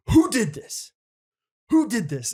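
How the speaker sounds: high, loud demanding counselor yell; angry counselor. Retold or imitated. Counselor demanding who threw the mayonnaise, mock yell. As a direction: bark it at the cabin like an angry counselor.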